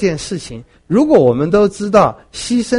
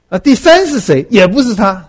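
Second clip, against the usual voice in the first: impatient king; 6 semitones higher, 7 dB louder than usual. Retold or imitated. King pressing, sage denying.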